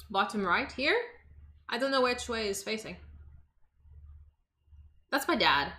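ordinary talk, nothing marked

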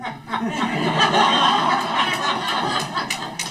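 hearty high laugh; laughing at his own story; high laughter. Real, not acted. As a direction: let the laugh roll.

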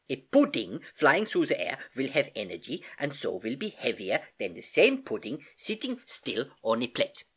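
put-on accented uncle teaching, key words lifted; patient Uncle Albert, explaining. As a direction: explain it like an eager old professor uncle. Uncle Albert lectures on heaviness.

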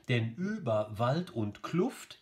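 slow beats on "Über WALD und KLUFT"; metrical scansion. Shown as a demonstration. Teacher reading up to the tricky line, slow scanning. Scanning the next verse aloud.

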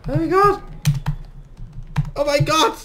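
loud, high, thrilled cries; overjoyed. Real, not acted. Thanking for the gift, loud high excited cries. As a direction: burst with delight. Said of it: "meine richtig eklige Lache".

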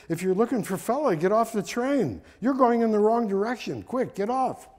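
high, loud urgent warning; shouting. Acted. Friend running alongside a departing train, shouting. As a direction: shout the instruction to someone leaving. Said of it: "Zeidemann shouted to his friend".

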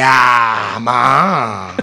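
loud mock call to mom. Son imitating how he calls his mom, a loud shout. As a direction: shout it across the house, short and loud. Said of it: "La comunicación de mi mamá y yo es como que".

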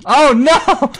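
high, loud cry of dismay with a laugh; panicked yell. Real, not acted. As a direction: shout in real alarm as it all goes wrong. Falls into lava.

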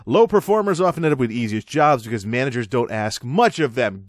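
ordinary talk, nothing marked

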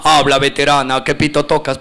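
low mock street-kid voice; coba street slang. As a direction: imitate a slang-talking kid hailing mom, pitched low. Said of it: "Le ven a la mamá". (voz grave de muchacho saludando en coba a su mamá)

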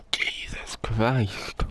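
slow breathy whisper. Real, not acted. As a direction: whisper it slowly, overwhelmed. Reacts to sour pain.

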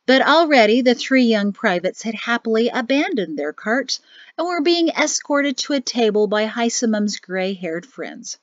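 plain reading voice

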